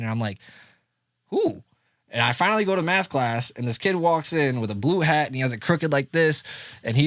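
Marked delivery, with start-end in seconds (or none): 1.3-1.6 high, startled question; puzzled high "who"; surprised. Retold or imitated. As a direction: jump up in pitch, caught off guard. Hearing about the new kid.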